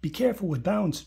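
put-on mild exclaim, read to kids. A narrator's reading. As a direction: blurt it out with worried eagerness. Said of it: "Dragon exclaimed".

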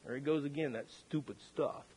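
low, very soft muttered thought; quiet inward grumble. Retold or imitated. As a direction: grumble privately, imitating a quiet wife. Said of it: "pretty quiet gal, she just thought".

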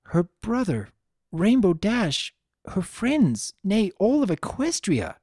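put-on a troubled princess recalling an old defeat; Princess Luna, worried. A narrator's reading.

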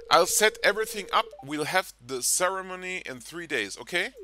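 hammed-up excited planning; eager fiancée. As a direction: read it like a giddy bride-to-be. Reading NPC's wedding plans.

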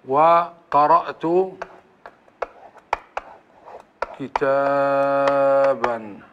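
very slow, كتاب and كتابا ending silent; sukun throughout. Shown as a demonstration. Teacher demonstrates the first dialect, very slow. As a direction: stretch then clip كتاب without tanween. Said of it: "عندما نقول".

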